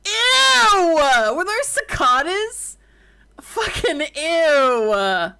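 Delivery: high, loud, grossed-out shrieks; disgusted shriek. Real, not acted. Disgusted by cicada sounds.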